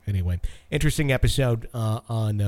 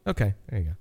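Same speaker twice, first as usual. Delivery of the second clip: ordinary talk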